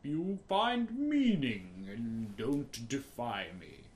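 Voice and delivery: slow, booming voice of God from above. Retold or imitated. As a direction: boom it out like a stern deity. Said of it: "the booming voice of fucking God".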